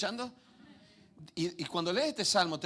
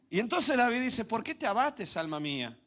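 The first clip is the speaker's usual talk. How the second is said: preaching loud; 8 semitones higher, 5 dB louder than usual. Real, not acted. Preaching at full force.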